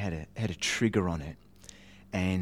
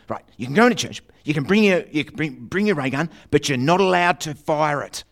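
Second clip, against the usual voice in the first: higher, louder strict mother laying down the law; stern mum; 6 semitones higher, 9 dB louder than usual. Retold or imitated. Mum warning her son before church, strict and loud. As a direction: warn him off firing it. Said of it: "she goes".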